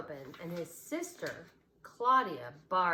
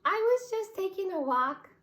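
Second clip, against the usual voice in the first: much higher, louder little rabbit voice; a giggly stuffed bunny covering up; squeaky toy rabbit; 13 semitones higher, 8 dB louder than usual. A narrator's reading. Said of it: "she said".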